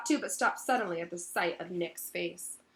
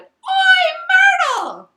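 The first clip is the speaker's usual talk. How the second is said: very high, loud bellowing call; loud shout; 19 dB louder, much slower than usual. Acted. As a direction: holler across the room at her. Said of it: "took a deep breath and bowed".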